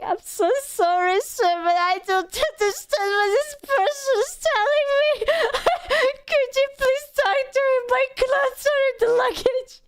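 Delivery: high, pleading, close to tears; near tears. Retold or imitated. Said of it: "on the brink of tears".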